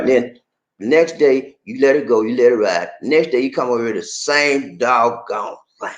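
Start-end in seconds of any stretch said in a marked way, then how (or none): none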